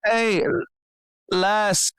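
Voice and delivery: high call into the fog; drawn-out, high-pitched hailing; hopeful shouting out. Acted. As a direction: call out for a lost companion. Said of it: "shouting out in a hopeful attempt".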